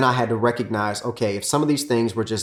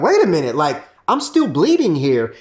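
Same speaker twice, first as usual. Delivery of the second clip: higher, louder, slowed protest; wife still hurt; 5 semitones higher, 5 dB louder than usual. Retold or imitated. Wife protesting to husband, higher hurt protest. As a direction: insist you're still hurting. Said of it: "she'd be like".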